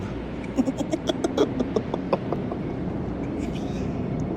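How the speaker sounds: breathy unvoiced villain giggle; whispered creepy laugh. Villain mocking rivals with whispered laugh.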